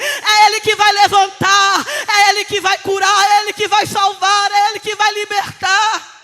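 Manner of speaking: loud, insistent, driving cadence; full-force preaching. Acted. Preacher urging listener to hand it to God, fervent shouting. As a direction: hammer out each repeated phrase.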